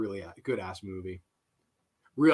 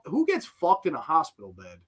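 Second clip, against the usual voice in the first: ordinary talk, nothing marked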